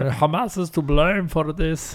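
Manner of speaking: slow, put-on German-Russian protest voice; German-Russian accent. Mimicking a protester blaming Hamas, mock German-Russian accent. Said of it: "like, a German Russian accent".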